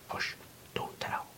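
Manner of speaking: soft, playful near-whisper; quiet, teasing secrecy; playful hush. A narrator's reading. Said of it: "Playfully she adds".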